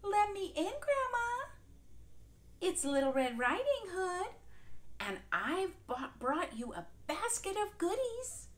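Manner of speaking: wolf pretending to be the girl; high sing-songy voice. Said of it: "in a high sing-songy voice".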